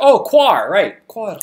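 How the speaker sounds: light up as it clicks; realizing. Retold or imitated. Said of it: "I was like".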